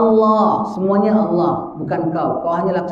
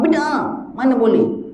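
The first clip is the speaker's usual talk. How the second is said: mocking; 7 semitones higher, slower than usual. Mock answer to the angel.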